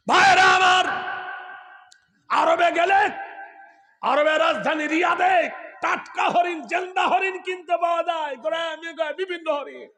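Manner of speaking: high, loud call to the brothers; ringing sermon shout, easing in volume at the end; full-force preaching. Real, not acted. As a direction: shout to the crowd, pitched high.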